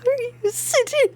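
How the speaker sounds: high cartoonish squeak at the character; squeaky high voice.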